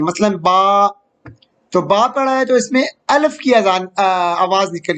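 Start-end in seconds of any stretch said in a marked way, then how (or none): 0.3-0.9 "با" with the long "aa" held; drawn-out vowel. Shown as a demonstration. Teacher showing students a lengthened zabar, "با" held long. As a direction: stretch the vowel of "با". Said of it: "جب ہم اس کو کھینچ کر پڑھتے ہیں".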